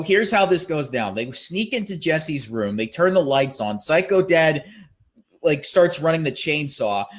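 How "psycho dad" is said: normal talking voice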